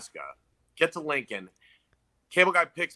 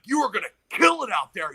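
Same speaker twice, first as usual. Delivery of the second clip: higher, pumped-up biker voice; hyped-up biker; 7 semitones higher than usual. Retold or imitated. Biker hypes the nervous comic before his set, pumped up. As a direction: pump him up like a big union biker.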